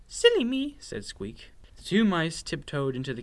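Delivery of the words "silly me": high, loud small-mouse voice; sheepish little mouse. A narrator's reading. Mouse admits his false alarm to his sister, sheepish. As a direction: pipe up high, owning the mistake.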